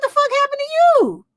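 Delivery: high, squeaky mock-shocked girl; high, shocked mock classmate. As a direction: gasp it out like a startled schoolgirl. Imitating a shocked schoolmate.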